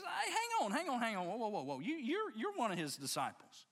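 put-on high, swooping teenage girl voice. Teenage girl stops Peter to accuse him, high and insistent. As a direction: act out a girl butting in, pitch leaping up. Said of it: "probably a teenage girl".

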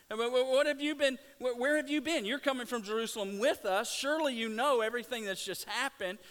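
fast, loud, high and astonished; incredulous disbelief. Retold or imitated. Cleopas telling the stranger he must know, fast and loud. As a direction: rush it out, amazed he doesn't know. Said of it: "this is the response that he gives".

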